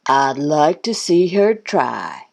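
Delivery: slow, breath-weakened groan. A narrator's reading. Goose after losing to Thistle, slow groan. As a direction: groan it out slowly, understated. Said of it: "groaned Goose".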